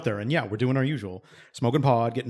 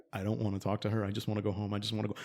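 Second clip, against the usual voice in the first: quiet inner plea; 3 semitones lower, 9 dB quieter, faster than usual. Retold or imitated. Inner voice, fight or flight.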